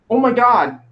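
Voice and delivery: hammed-up dismay; voiced commercial girl. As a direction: imitate a shocked girl in an ad. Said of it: "And the girl says".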